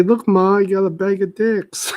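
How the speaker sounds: high, loud proud shout to mom; proud kid. Pretend.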